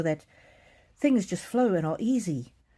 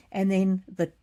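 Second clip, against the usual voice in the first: normal talking voice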